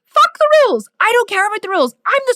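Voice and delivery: fierce, proud rejection of the rules; defiant shout. Real, not acted. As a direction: throw the rules out at full volume. Defiant answer to critics.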